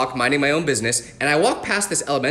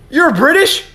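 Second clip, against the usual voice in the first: high, loud disbelieving question; shocked to hear the ghost is British; surprised; 11 semitones higher, 9 dB louder, slower than usual. Acted. Boy reacts to British ghost.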